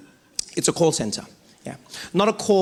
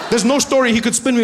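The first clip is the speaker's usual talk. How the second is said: usual speaking voice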